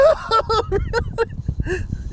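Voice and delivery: giddy high-pitched laughing; excited high laugh. Real, not acted. Fish pulling hard on line.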